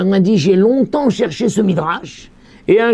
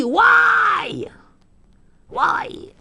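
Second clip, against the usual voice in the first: much higher whispered why, fading; mock wail. Rabbi acting out souls in snow crying why in anguish. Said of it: "ils crient".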